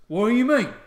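loud, high orc challenge; bickering orc. Acted. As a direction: demand an answer as a baffled orc. Orc guards squabble over petting dog.

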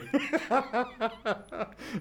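high-pitched burst of laughter; long, high giggling laugh; mischievous laugh. Real, not acted. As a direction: crack up at your own prank. Laughs at his own dunking prank.

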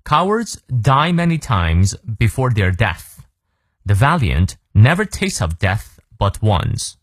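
slow, measured model reading in English; slow read-through. Shown as a demonstration. Teacher runs through the whole quote for learners, slow model reading. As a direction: take it slowly from start to end. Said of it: "再来一遍".

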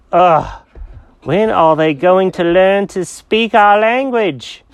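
high, slow, fed-up cat voice; drawn-out, high-pitched exasperation; exasperated cat Jenkins. Acted. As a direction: groan and complain as a misunderstood cat. Cat exasperated at not being understood.

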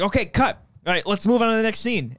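high, upbeat director's call; eager director. Retold or imitated. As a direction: play a film director hurrying the shoot along. Acting out director on set.

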